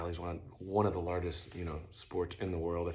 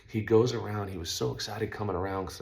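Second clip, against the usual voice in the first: ordinary talk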